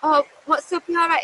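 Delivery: high-pitched, would-be friendly hello; put-on casual greeting. Acted casual greeting while scared.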